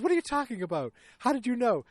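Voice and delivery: high-pitched mock protest; flustered protest. Daughter caught out over the cruise, flustered.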